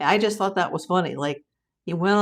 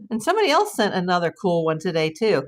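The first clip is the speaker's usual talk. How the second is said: ordinary talk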